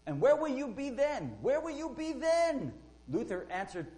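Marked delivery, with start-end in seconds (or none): 0.1-2.7 loud, high, furious cry; furious demand. Retold or imitated. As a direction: hurl the question at Luther like a threat. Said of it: "he said to him furiously".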